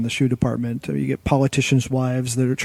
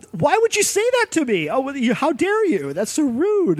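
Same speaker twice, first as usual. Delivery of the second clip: high, indignant mock outrage; offended customer; 12 semitones higher than usual. Customer snaps at saleswoman over rude remark, indignant high voice. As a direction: act out a customer taking offence, pitched high. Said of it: "And the customer's like".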